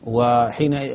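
normal talking voice

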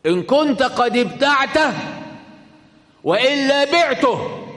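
high, loud call of the Bedouin. Retold or imitated. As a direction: call out loudly, pitched well above normal speech. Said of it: "فنادى الأعرابي".

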